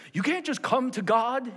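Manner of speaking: high, urgent evangelist's proclamation; Billy Graham. Retold or imitated. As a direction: imitate an urgent crusade preacher. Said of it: "You know how he preached".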